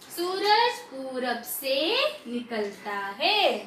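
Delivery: slow sentence reading with drawn-out vowels. Shown as a demonstration. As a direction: read word by word for learners, slowly. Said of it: "पहले धीरे धीरे पढ़ना सीखो". (धीरे-धीरे, मात्रा खींचकर पढ़ना)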